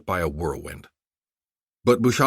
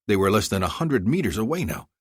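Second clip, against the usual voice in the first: ordinary delivery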